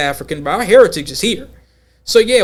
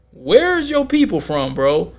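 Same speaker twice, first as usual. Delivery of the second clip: drawn-out "Where's your people from", pitched up, suspicious; probing question; slower than usual. Retold or imitated. Quizzing a man about his origins, mock suspicious.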